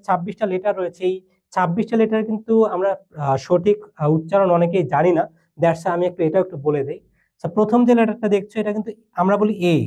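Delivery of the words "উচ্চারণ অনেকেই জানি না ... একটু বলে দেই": usual speaking voice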